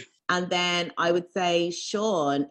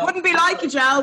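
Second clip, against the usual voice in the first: high, loud teasing voice; mock voice; 9 semitones higher, 5 dB louder than usual. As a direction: tease him in a silly put-on voice. Teasing Joel in put-on voice.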